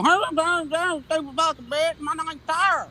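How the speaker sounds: mocking, slurred imitation of a ranting woman; Tara. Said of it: "when you get up here and you go".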